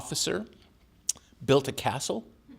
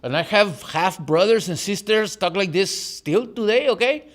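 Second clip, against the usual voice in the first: higher-pitched Tijuana-accented English; 7 semitones higher, 4 dB louder than usual. Shown as a demonstration. Author showing the audience his childhood speech, Tijuana accent. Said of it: "with a Tijuana accent".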